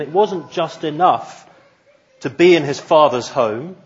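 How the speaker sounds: ordinary delivery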